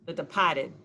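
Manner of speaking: "Departed" with the r dropped, de-PAH-ted; "Departed" as a Bostonian says it. Shown as a demonstration. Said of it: "say it like you're from Boston".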